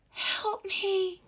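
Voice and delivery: high, loud cry for help; frightened woman on the riverbank crying out for help; desperate woman calling. A narrator's reading. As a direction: plead from far off across the water. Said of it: "she called".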